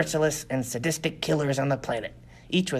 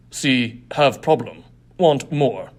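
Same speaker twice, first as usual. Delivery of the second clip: louder, broken-speech thug voice; brutish gang boss; 6 dB louder, slower than usual. Acted. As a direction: bark it like a greedy brute.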